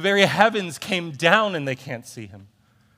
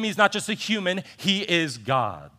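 normal talking voice

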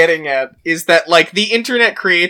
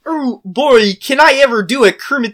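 Kermit the Frog; 4 semitones higher than usual. Pretend. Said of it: "can you do a Kermit the Frog voice".

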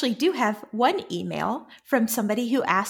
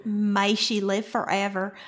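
slow, broad Texan vowels; Texan drawl. Shown as a demonstration. Host echoes the Seanchan title, slow Texan drawl. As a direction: stretch it out in a Texan accent. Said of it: "I know what that accent sounds like".

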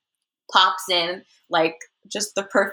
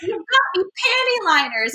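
exasperated; 10 semitones higher than usual. Retold or imitated. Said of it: "she was like".

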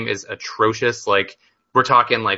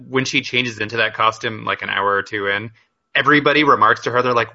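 ordinary delivery

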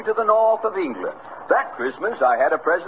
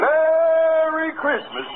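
high, slow, drawn-out Merry Christmas; sing-song jolly Santa voice; Santa's greeting; 12 semitones higher, much slower than usual. Acted. Santa greeting the emperor Nero, high and slow.

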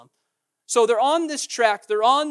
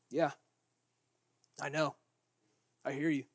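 patient; 6 semitones lower, 10 dB quieter than usual. Acted. Jesus listening patiently.

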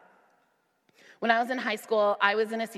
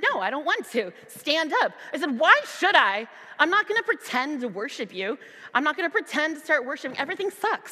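put-on higher, louder teen protest; sulky yelling at God; angry outburst; 7 semitones higher, 4 dB louder than usual. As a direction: snap back like a defiant, angry teenager. Retold angry rant at God.

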